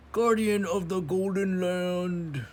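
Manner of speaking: high, loud cartoon announcer voice; exaggerated cartoon intonation. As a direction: boom it out like a cartoon hero. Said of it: "I talk like a cartoon character".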